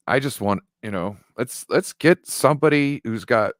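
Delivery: ordinary talk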